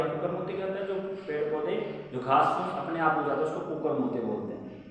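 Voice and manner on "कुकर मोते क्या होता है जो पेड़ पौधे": usual speaking voice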